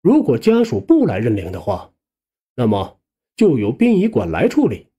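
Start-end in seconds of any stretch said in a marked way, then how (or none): none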